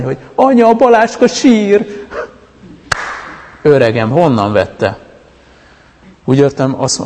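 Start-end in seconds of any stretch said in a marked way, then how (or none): 0.3-2.1 put-on high-pitched, drawn-out little-girl yell; child's tattling shout. Little girl calling mum about her crying brother, high loud shout. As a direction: call out loudly like a toddler telling on someone. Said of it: "elkezdett kiabálni".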